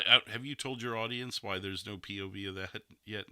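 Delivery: ordinary delivery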